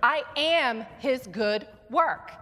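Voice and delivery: "I am his good work" slow and strong, stress on "am"; emphatic declaration. Shown as a demonstration. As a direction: proclaim it like you mean it. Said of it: "say it with emphasis and like you mean it".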